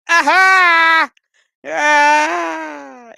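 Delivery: high loud hammed-up "aha" yelp; mock triumphant yelp. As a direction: belt out a cartoonish cry of triumph.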